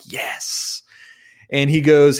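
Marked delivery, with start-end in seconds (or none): 0.0-0.8 whispered yes under the breath; secret yes. Real, not acted. To himself as the opponent folds, a whispered yes. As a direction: celebrate silently so he can't hear.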